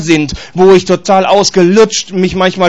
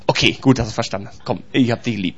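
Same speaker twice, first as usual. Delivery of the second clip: low, soft, forgiving voice; gentle reassurance; 7 semitones lower, 7 dB quieter than usual. Acted. God reassuring Patrick after scolding him, gentle. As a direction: drop down soft and affectionate.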